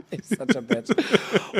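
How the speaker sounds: high, slow words through laughter; real helpless laughter while speaking; laughing tease. Real, not acted.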